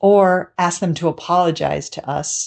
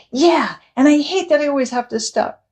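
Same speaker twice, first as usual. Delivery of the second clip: put-on upset child; 9 semitones higher than usual. Parent voices the child.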